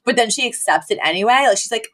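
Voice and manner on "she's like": usual speaking voice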